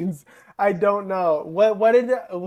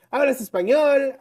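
much higher, louder 'Hablas español?'; mock security guard; 10 semitones higher, 5 dB louder than usual. Retelling the museum guard asking in Spanish, high mock voice. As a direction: chirp the guard's question.

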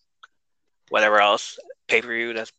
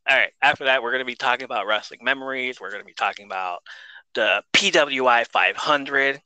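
usual speaking voice

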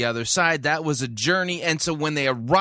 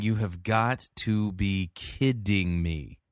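eye-rolling disbelief; 4 semitones lower, slower than usual. Retold or imitated. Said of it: "I was literally rolling my eyes going".

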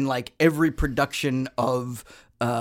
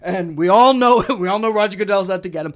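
loud, high mock-indignant Patriots defense; defensive Boston fan; 4 semitones higher, 4 dB louder than usual. Boston fan defending Tom Brady in a mock huff. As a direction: puff up and defend Brady like a diehard. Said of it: "I'm saying that about the Patriots".

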